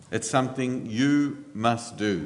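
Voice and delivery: "you" leaned on firmly, then "must do" falls away; stress on you. Shown as a demonstration. Preacher telling the husbands that they themselves must act, stress on "you". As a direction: press down on "you" to make it personal. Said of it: "The emphasis is on the you".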